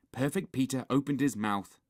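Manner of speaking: usual speaking voice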